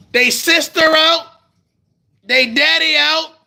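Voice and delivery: fed-up yelling lecture; exasperated shout. Real, not acted. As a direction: bark at the hard-headed audience. Scolding the audience, then shouting.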